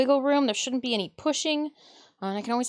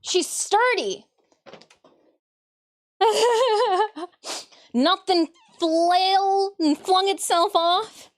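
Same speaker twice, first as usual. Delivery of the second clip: delighted; 8 semitones higher, 5 dB louder, slower than usual. Real, not acted. Delighted at sturdy build.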